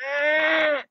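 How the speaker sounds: high, held mock baby cry; put-on whiny infant cry; imitated baby wail. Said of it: "that baby's gonna be like".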